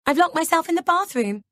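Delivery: high, loud fake panicked shout. A narrator's reading. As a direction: shout it through the door in mock alarm. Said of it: "I hear her shout".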